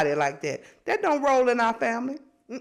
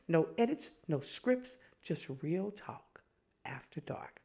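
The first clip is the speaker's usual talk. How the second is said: whispered tagline; 5 semitones lower, 10 dB quieter than usual. Acted. Closing the show for listeners, whispered. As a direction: let it fade into a hush.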